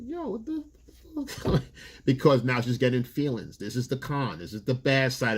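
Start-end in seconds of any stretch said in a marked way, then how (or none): 0.1-1.6 high, hushed what-the; stunned, pulling back; mock disbelief. Young guy stunned by her jealousy, mock high and soft.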